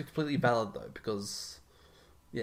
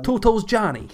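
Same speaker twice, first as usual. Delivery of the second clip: high, loud New York wiseguy voice; hammed-up New York mobster; New York gangster accent; 10 semitones higher, 9 dB louder than usual. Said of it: "that's the New York accent".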